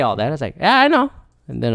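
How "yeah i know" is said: high, short mock-polite answer; retold calm reply. Visitor holding back a retort, high mock-calm voice. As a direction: imitate a held-back reply, pitched high. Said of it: "I just calmly said".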